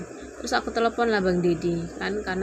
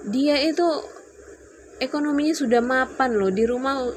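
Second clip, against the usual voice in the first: higher, raised-voice reproach; retold yell; 6 semitones higher, 3 dB louder than usual. Wife holds up a friend as example, mild retold yell. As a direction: press the point loudly, as if retelling a row. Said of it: "Terus aku teriak kan".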